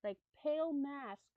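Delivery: measured, slightly hushed storytelling; story narration. Acted. Streamer reads creepy camping story to viewers, storytelling voice. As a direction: read it like a campfire tale.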